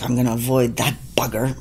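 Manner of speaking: low, quick whispered aside; muttered resolve. Retold or imitated. Arriving backstage, resolving to dodge him, muttered aside. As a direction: mutter it fast under your breath.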